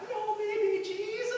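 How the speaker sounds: put-on high, slow scandalised old-nun cry; nun yelling. Old nun yelling out in shock at the class. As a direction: cry it out aghast. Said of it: "yells out".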